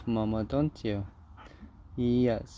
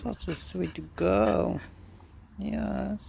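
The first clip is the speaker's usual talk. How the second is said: high cooing praise; 6 semitones higher, 3 dB quieter, slower than usual. Real, not acted. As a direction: coo it up high to the dog.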